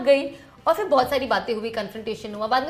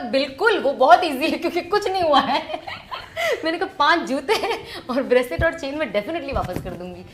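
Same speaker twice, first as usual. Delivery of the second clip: amused, louder retelling with laughs breaking through; laughing while retelling; 4 semitones higher, 6 dB louder than usual. Retold or imitated. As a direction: laugh through the words as you recount it. Retells handing back his gifts. (हँसते हुए, ज़ोर से सुनाना)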